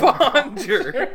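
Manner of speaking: high laughing bonjour; mock bonjour. Brother laughs at the bad French and squeaks bonjour. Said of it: "how do you say it?".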